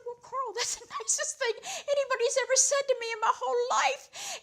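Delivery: high, loud and laughing through the words; delighted laugh breaking in; teacher laughing with joy at Carl's confession. Retold or imitated. Laughing reply to Carl.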